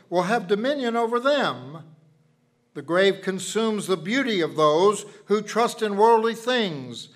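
loud, fervent pulpit preaching. Acted. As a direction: preach it out with full conviction. Preaching the Psalm to congregation.

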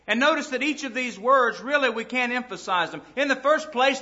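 ordinary delivery

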